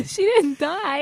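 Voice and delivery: high, drawn-out words through laughter; laughing protest. Real, not acted. Listener insisting nobody died, laughing high and slow. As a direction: defend yourself while cracking up. Laughing rebuttal to being chided.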